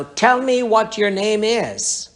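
high, slow, insistent command; commanding demand. Retold or imitated. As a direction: demand it firmly of a demon. Interrogating the demon.

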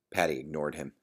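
usual speaking voice

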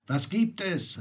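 high-pitched, puzzled question; a surprised cry of inquiry; astonished. A narrator's reading. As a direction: call it out in wonder. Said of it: "rief ich verwundert".

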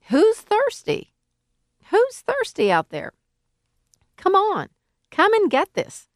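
very high-pitched crowd call. Acted. Preacher inviting whoever is thirsty out there, calling out. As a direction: summon people from afar in a high, carrying voice.

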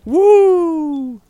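high loud whoop; excited whoop. Real, not acted. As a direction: cheer it out.

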